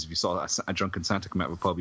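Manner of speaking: normal talking voice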